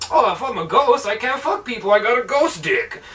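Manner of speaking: mock dismay. Movie hero realizing he's a ghost, mock dismay. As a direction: imitate a ghost's silly dismay. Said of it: "because he realizes".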